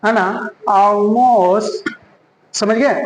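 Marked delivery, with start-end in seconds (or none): 0.7-1.9 Almost as a long "aw-most", L hidden; drawn-out Almost with rounded first vowel; dark L demo. Shown as a demonstration. Teacher models it for learners, dark L demo. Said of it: "बल्कि हम क्या बोलेंगे".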